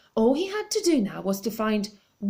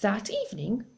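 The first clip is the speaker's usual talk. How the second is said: ordinary delivery, nothing marked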